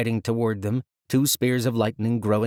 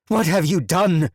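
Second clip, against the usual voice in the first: loud, higher accusing shout; 8 semitones higher, 6 dB louder than usual. Acted. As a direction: shout over the din. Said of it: "Watani shouted over the screams".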